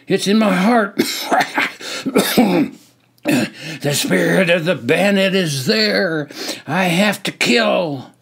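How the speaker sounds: loud, high, hoarse and coughing; hoarse coughing shout. Acted. Soldier feigning madness, hoarse shouting. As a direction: rasp it through a sore throat. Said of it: "hoarse throat coughing in my voice".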